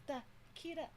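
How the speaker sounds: half-sing the trend line quietly; mock song hook. Teaching idols a trending pose, hook voiced quietly.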